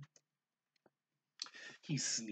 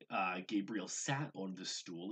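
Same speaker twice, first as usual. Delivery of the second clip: plain reading voice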